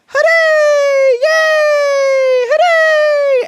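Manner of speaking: shrill falsetto cheer, very high and loud; squeaky video game character cheering hooray; shooting game guy cheer. Retold or imitated. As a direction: scream hooray like a cartoon cardboard cutout. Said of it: "and he goes".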